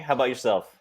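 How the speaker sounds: ordinary talk, nothing marked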